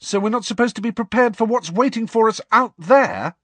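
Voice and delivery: high, loud, heated question; indignant challenge. A narrator's reading. Student Harry challenging professor on being prepared, indignant. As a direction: demand it indignantly, pitched right up.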